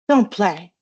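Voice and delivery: quick, loud urgent order. A narrator's reading. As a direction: blurt it out at once. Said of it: "said Hermione at once".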